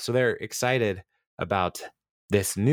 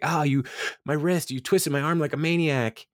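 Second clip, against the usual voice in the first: mock-hurt, higher-pitched whine of protest; pretending to be injured; 6 semitones higher than usual. Podcaster retelling Tom's fake injury to Jeremy, mock hurt. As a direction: imitate someone lightly faking an injury. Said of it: "pretending to be injured".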